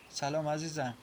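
ordinary delivery

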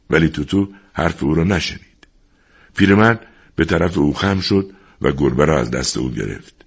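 plain reading voice

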